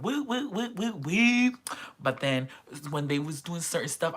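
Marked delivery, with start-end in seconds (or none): 0.0-1.5 high, whooping woos; imitated fan cheering. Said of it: "I was like".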